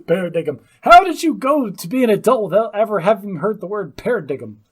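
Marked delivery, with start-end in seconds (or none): 0.1-0.6 "paradigm" said as PA-ra-DIG-um; mispronounced paradigm. Shown as a demonstration. Co-host teases the host's mistake, "paradigm" as para-DIG-um. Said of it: "What's the G for?". 4.0-4.6 "paradigm" with a hard G, para-DIG-um; mock the misreading of "paradigm" as para-DIG-um; mispronounced paradigm. Co-host asks how he never heard it, "paradigm" as para-DIG-um. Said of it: "What's the G for?".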